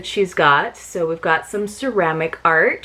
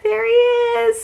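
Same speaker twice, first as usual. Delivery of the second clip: much higher, excited little greeting; delighted discovery; slower than usual. Real, not acted. Finding the sheep in the box, delighted and high. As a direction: greet him like a found friend.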